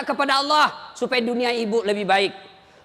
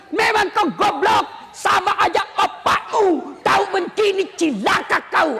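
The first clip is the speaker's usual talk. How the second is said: mock furious shout at the husband, slow on the insult; cursing wife; 7 semitones higher than usual. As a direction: ham up a wife hurling insults. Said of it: "yang tidak boleh maki-maki suami". (istri memaki suami sambil berteriak)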